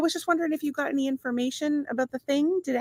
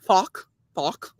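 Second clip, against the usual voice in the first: mock Irish accent; 3 semitones lower than usual. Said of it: "when Irish people say".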